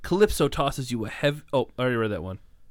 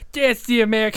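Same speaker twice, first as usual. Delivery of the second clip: high, hammed-up Cockney; mock British accent; 9 semitones higher, 5 dB louder than usual. Reader trying the agent's line, high mock British. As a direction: start a squawked bad British accent.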